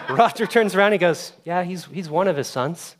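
can barely tell it for laughing; real laughter breaking up the story; laughing while telling. Real, not acted. Retelling a funny moment.

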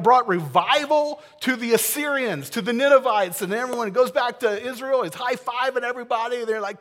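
loud, high, gushing happy-ending hype; excited. Pretend. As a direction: sell the fairy-tale ending with big enthusiasm. Imagined happy ending, hyped.

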